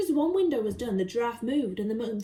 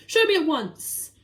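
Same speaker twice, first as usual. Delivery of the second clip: loud, slow command; commanding order; 4 semitones higher, 6 dB louder than usual. Acted. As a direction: order it at once. Duke demands urgently.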